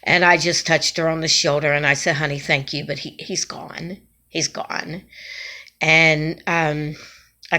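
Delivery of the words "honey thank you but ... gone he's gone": soft, low, tender reassurance; gentle comfort. Retold or imitated. Telling a woman her husband has died, softly. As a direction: break the bad news gently, fading quiet. Gently breaking news of death.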